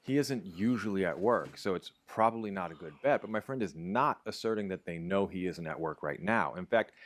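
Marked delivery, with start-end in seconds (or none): none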